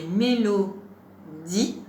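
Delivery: "Mélodie" slow, with a gap before the last syllable; slow down and detach the end of "Mélodie"; dernière syllabe détachée. Shown as a demonstration. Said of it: "qui a la syllabe di". (« Mélodie » lentement, dernière syllabe à part)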